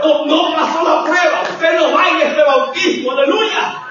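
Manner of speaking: high, loud pulpit proclamation; shouted, fervent preaching. Real, not acted. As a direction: declare the verdict at preaching force.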